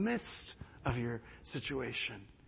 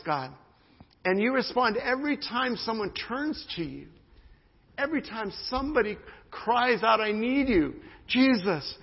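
higher and louder, pleading prayer building in force; fervent prayer; 7 semitones higher, 9 dB louder than usual. Acted. Pastor praying over the church with rising passion.

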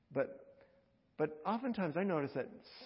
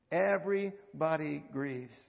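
slow repeat; 4 dB louder, slower than usual. Acted.

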